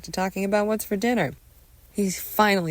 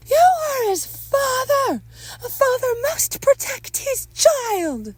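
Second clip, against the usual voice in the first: very high, slow, loud reprimand; scolding wife; 6 dB louder, slower than usual. Acted. Wife scolds husband about protecting son, high stern voice. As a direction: lay down the law, slowly and high.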